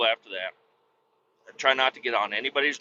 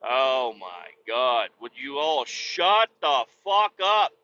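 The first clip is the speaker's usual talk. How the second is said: slow exasperated; slower than usual. Retold or imitated. Fed-up retort to them.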